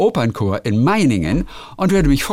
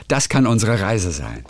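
ordinary talk, nothing marked

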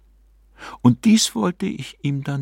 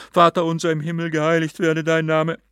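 fast, higher rattled-off Lord's Prayer; hasty droned prayer; 7 semitones higher, faster than usual. A narrator's reading. Hermit praying in panic against the demon, fast higher drone. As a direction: gabble the prayer in fervent haste. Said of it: "vor lauter inbrünstiger Hast geleiertes Paternoster".

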